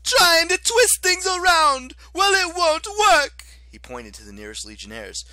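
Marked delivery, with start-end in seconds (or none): 0.0-3.5 much higher, much louder, slow shrieking augur; whiny high-pitched fury, dragged out; Octavian, shrill and outraged. Acted. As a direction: screech like a wounded petty tyrant. Octavian character voice.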